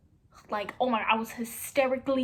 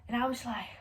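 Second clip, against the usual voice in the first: ordinary talk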